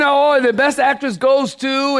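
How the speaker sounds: high showy presenter voice; grand awards announcement. Retold or imitated. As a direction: announce the winner with flourish.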